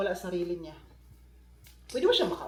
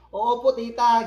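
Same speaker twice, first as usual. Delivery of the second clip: loud eager reply to auntie; 2 semitones higher, 11 dB louder, much slower than usual. Retold or imitated. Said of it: "sabi-sabi ni tita sa akin".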